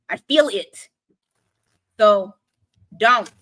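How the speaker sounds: normal talking voice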